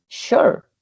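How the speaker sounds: "sure" high and unvoiced, almost whispered; breathy model word. Shown as a demonstration. Teacher repeating "sure" for the diphthong lesson, high and breathy. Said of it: "I talk about these diphthongs".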